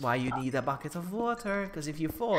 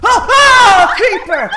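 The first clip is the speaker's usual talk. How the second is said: very high, very loud scream with laughter; panicked shout; 23 dB louder, much slower than usual. Real, not acted. A creeper sneaks up in Minecraft, screaming laughing warning. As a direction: shriek the warning.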